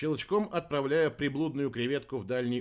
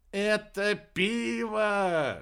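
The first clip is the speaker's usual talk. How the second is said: high, stretched-out joyful cry; broad smile; 8 semitones higher, 4 dB louder, much slower than usual. A narrator's reading. Aerba greeting a beer delivery, delighted. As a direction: hail it with a wide grin, vowels stretched. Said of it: "широко улыбнулся Уэрба".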